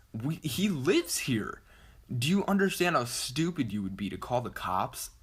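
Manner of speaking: high, emphatic exasperated retort; retold indignant protest. Friend answering sister's threat to call the cops, acted indignation. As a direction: argue back, pitching up in disbelief.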